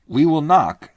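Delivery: a resolute man declaring the plan; firm Leo. Acted. Leo Mathienne speaks.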